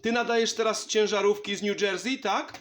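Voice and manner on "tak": ordinary talk, nothing marked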